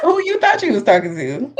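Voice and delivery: laughing sassy echo. Real, not acted. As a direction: laugh while repeating it. Echoing with laugh.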